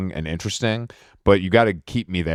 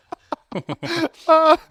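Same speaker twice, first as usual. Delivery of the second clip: high laughter. Real, not acted. Laughing at the bit.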